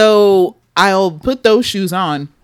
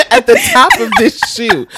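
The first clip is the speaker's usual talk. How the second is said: laughing. Real, not acted. Laughing while describing shoe.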